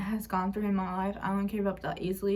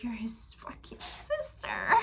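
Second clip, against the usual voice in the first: whispered, slow and biting; slow whispered retort from mom to godmother; hissed whisper; 2 semitones higher, 6 dB quieter, slower than usual. Retold or imitated. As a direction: whisper it through your teeth at her. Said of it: "And Mom was like".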